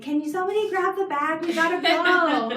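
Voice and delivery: mock frantic hurrying. Mom calls to her family to grab the bag, mock frantic. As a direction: act out rushing everyone out the door. Acting out herself rushing the family out.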